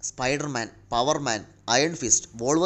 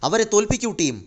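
high, raised-voice rallying shout; rally the team into battle; battle cry; 9 semitones higher, 4 dB louder than usual. A narrator's reading. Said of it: "captain അലറി". (ഉയർന്ന സ്വരത്തിൽ അലറി)

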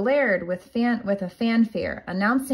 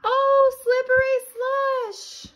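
startled exclamation. Acted. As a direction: squeal as the skate flies off. Little Red exclaims on falling.

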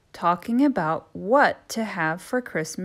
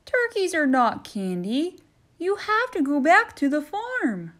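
louder, higher stern elf; christmas elf; 8 semitones higher, 4 dB louder than usual. Acted. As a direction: send the turkey packing. Elf voiced.

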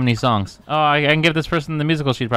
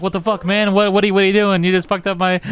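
high-pitched imitation of an outraged guy; mock pissed-off; 6 semitones higher than usual. Streamer voicing the guy whose throw got ruined, mock pissed-off. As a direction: act out his indignant protest. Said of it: "He's pissed. He's like".

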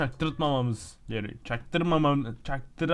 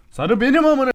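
high, loud exclamation; heated outburst; 10 semitones higher, 9 dB louder than usual. Real, not acted. Gamer reacting to the yellow base, heated outburst. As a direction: burst out with sudden volume.

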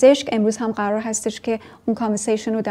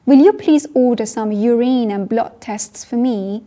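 slow model sentence; 5 dB louder, much slower than usual. Shown as a demonstration. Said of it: "model sentence".

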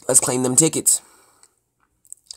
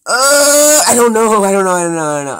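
loud, high, starting on a long wail; flustered panic; 8 semitones higher, 9 dB louder than usual. Pretend. Player torn between side street and red light, flustered panic. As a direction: blurt it over and over, panic easing off. Timed choice in a car chase.

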